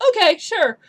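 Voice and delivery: high, loud, eager agreement; Gertruda. Acted. Doll girl nods aggressively, high emphatic voice. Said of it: "Gertruda just nods aggressively".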